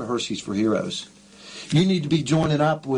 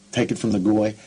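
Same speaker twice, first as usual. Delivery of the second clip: ordinary talk, nothing marked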